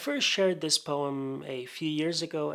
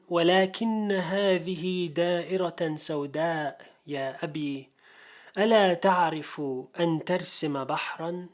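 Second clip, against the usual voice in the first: measured declamation with firm line openings; Arabic poetry recitation; 3 semitones higher, 4 dB louder, faster than usual. Acted. Poet reads a father-and-son poem to an audience, declaimed recitation. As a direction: recite it formally, phrase by phrase, pausing between verses.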